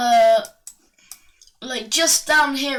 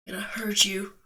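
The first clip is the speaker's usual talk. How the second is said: softer, unvoiced aside; Mario's muted threat; 2 semitones lower, 5 dB quieter, faster than usual. Mario threatening the Goomba, dropped to near whisper. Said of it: "And Mario's like".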